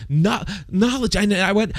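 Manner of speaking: a touch of high, strained, winded pleading; half-drowned young man, barely able to speak; struggling to get it out. Retold or imitated. Young man to Socrates after being dunked, strained and winded. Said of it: "he couldn't even get it out".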